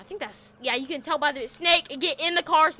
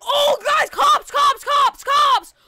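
yelling a warning at full volume, pitched up; panicked shout; 9 semitones higher, 9 dB louder, much slower than usual. Real, not acted. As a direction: scream it out to your friends, real alarm. Panicked warning about police arriving.

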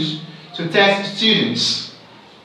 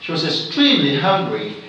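quicker line, leaning on "extremely"; stress on extremely; faster than usual. Shown as a demonstration. Teacher repeating shifted stress, emphasis on "extremely". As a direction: hit "extremely", let "hungry" fall. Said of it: "I'm gonna stress now is this".

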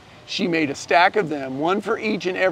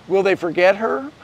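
ordinary delivery

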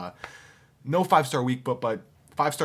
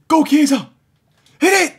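loud, high cheer to shoot; urging shout; 14 semitones higher, 14 dB louder than usual. Real, not acted. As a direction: yell him on to shoot. Gamer urging a shot.

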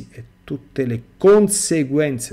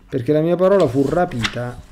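normal talking voice

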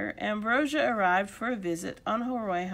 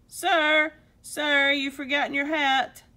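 loud, higher-pitched call; urgent polite hail, raised voice; calling after someone; 5 semitones higher, 6 dB louder than usual. Acted. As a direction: shout to catch his attention. Old gentleman calls after Nacho.

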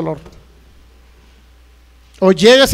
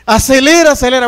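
loud, raised-voice plea to the driver; acted panicky hurry, pushed louder; urgent urging; 3 semitones higher, 6 dB louder than usual. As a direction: urge the driver to speed up.

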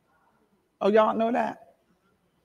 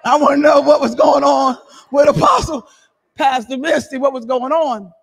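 much louder, calling across the church; 8 dB louder than usual. Real, not acted. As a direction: shout the question to the room. Loud call to Pastor Misty.

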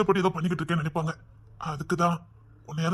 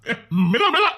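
loud urging; 3 semitones higher, 7 dB louder than usual. Acted. Urging her to eat slowly.

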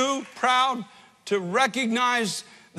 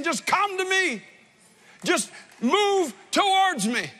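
higher, slow tender summons; Christ calling; 6 semitones higher, slower than usual. Acted. Christ calling a believer by name, a slow higher summons. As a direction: beckon gently from above, slow. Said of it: "that voice of Christ calling your name".

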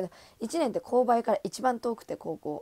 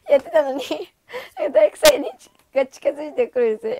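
laughing through words; 6 semitones higher, 12 dB louder than usual. Real, not acted. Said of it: "laughing hard while telling".

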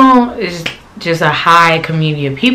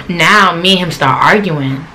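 usual speaking voice

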